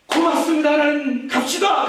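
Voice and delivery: high, loud, hammed-up thanks; mock joyful shout. King answers God's death call, hammed-up joyful shout.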